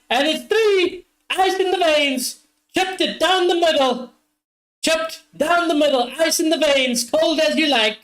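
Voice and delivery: high, excited TV commentary on the penalty; football commentator. Pretend. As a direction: call the goal like a match commentator. Mock TV commentator call of penalty.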